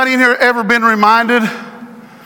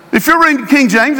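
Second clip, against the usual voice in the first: ordinary talk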